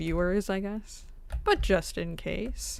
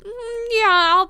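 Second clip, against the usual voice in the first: sarcastic; 14 semitones higher, 11 dB louder than usual. Pretend. Sarcastic mock answer.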